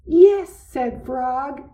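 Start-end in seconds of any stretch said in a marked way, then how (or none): 0.1-0.5 much higher, louder happy cry; joyful yes. A narrator's reading. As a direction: cheer it out. Kite finally flies, joy.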